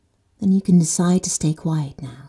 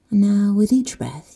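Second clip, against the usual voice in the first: ordinary talk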